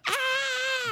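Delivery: high, short fake scream; mock scream. Joking about water allergy, mock pained scream. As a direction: yelp like water burns you. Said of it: "They're like".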